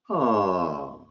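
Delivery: a warm falling ahh of understanding. Shown as a demonstration. Clerk reacting warmly to a guest's news with ahh. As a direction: sigh it out with warmth. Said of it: "Are you ready?".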